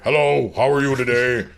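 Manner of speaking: attempted put-on deep actor voice; lightly mock a forced deep delivery; Kelsey Grammer. Shown as a demonstration. Demonstrating how the actor sounds in the movie, mock deep voice. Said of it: "to give this really deep voice".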